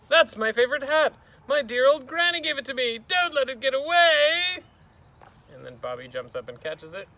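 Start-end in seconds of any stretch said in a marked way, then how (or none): 0.1-4.6 loud, very high frantic shout; high, loud alarmed teacher; panicked cry. Acted. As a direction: cry out for help chasing a runaway treasure. Teacher panics as hat blows away.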